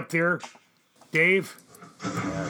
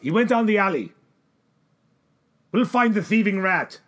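loud angry search calls; shouting angrily; 6 semitones higher, 9 dB louder than usual. Acted. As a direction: bark it out hunting him. Pursuers searching for thief.

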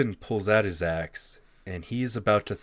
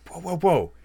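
alarmed halt; 7 semitones higher than usual. Acted. Herschel halts the tank.

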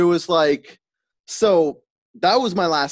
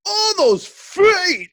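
high, slow, drawn-out shout; loud call-out; 6 dB louder, much slower than usual. Retold or imitated. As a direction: yell it across the theater. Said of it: "Like, as loud as I could".

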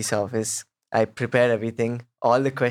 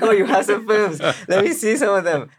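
higher, louder, laughing excitement; an officer gets excited watching the films, laughing and much louder; hyped officer; 8 semitones higher, 4 dB louder than usual. Retold or imitated. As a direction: imitate the officer getting hyped up. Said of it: "he was getting hyped".

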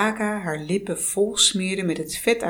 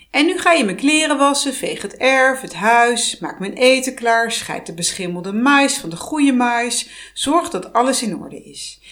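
loud, fast, higher witch barking orders; bossy witch commands; 4 semitones higher, 5 dB louder than usual. Acted. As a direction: rattle off chores like a domineering hag. Heks geeft bevelen aan Vasalisa. (luide, snelle heksenbevelen)

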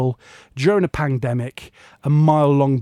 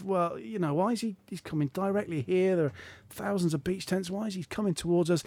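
quiet suspicious thought; 4 semitones higher, 10 dB quieter than usual. Retold or imitated.

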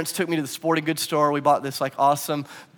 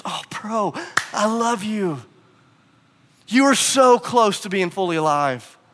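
pleading love; 5 semitones higher, 4 dB louder, slower than usual. Acted. Jesus pleading lovingly, as retold.

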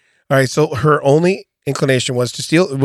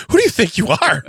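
laughing indignation; 11 semitones higher, slower than usual. Acted. Indignant suspect voiced, laughing.

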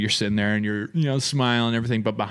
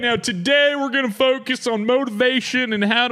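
preacher voice; 11 semitones higher than usual. Retold or imitated. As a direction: preach it like a motivational speaker. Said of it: "I feel too much like a preacher".

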